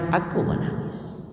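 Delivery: whispered tenderly, almost breathless; soft whisper. Acted. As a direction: hush it as a father's tender confession. Prophet's words quoted tenderly. (bisikan lembut penuh haru)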